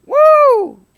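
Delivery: loud, high preacher's whoop; a sudden high woo from the pulpit. Real, not acted. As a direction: let out a joyful whoop. Preacher's exclamation.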